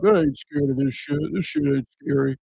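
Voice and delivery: high mocking tough guy; high-pitched mock bravado; cocky jock. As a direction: sneer like a macho jock. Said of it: "it's like".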